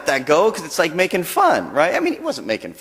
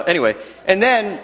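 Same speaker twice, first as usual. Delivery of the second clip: usual speaking voice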